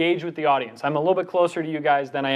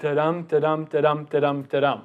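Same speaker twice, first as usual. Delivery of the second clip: five even beats, each "dum" stressed after a light "da"; metered "da-dum" demo, stress on every second syllable; iambic rhythm; 3 semitones lower than usual. Shown as a demonstration. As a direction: chant "da-dum" in steady weak-strong pulses. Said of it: "taking a deep breath".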